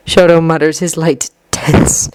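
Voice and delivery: plain reading voice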